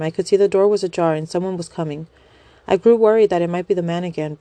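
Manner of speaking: ordinary delivery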